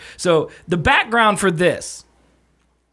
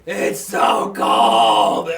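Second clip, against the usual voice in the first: slow, drawn-out shouting wince; sensitive teeth wincing; 6 semitones lower, much slower than usual. Real, not acted. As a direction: drag it out through the cold. After chugging cold beer.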